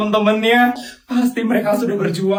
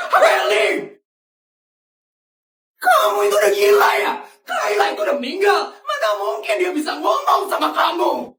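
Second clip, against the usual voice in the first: father's furious yell, much higher; angry shout; 10 semitones higher than usual. Acted. Father yells at Feli for claiming she saw Kayla, furious shouting. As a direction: scold her at full voice, outraged.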